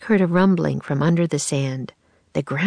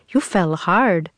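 usual speaking voice

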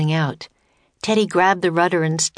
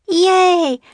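very high, loud cheer; jubilant call; 13 semitones higher, 6 dB louder than usual. Acted. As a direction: cheer out in delight. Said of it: "called Annie".

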